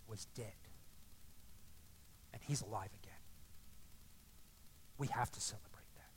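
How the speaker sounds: hushed, low, fading to a whisper; very calm. Acted. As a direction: let the father's words sink almost to a whisper. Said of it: "Very calmly says".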